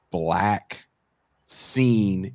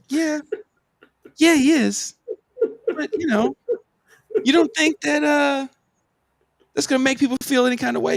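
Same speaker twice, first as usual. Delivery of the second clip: high-pitched careful prodding, retold; pointed leading question; 4 dB louder than usual. Bandleader presses fan about the patch, high pointed prodding. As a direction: nudge them toward the obvious, voice held high.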